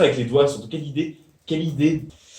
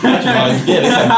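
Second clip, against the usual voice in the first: laughing; 9 semitones higher, 7 dB louder, faster than usual. Real, not acted. Laughing at friend's penalty.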